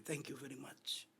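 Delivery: very soft, slow thank you; quiet mock thanks; murmured thanks. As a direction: say it under your breath.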